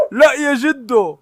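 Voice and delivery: high, slow pleading cry; drawn-out plea. Real, not acted. Owner begging the grandpa dog to stop, drawn out and high. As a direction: stretch it out high.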